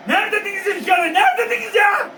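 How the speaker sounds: high, loud taunting yell; excited shouting. Real, not acted. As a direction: shout it at the team in joy. Fan yells at team after goal.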